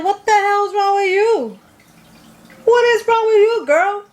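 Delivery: high, loud, shocked yell; hollering at her in disbelief; Michael yelling. Retold or imitated. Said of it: "Michael's like".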